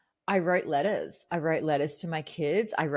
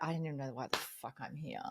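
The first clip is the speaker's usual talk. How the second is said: low, quick, much softer grumble; muttered complaint; 5 semitones lower, 12 dB quieter, faster than usual. Retold or imitated. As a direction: mutter it under your breath, fast. Coach mutters his complaint, quoted.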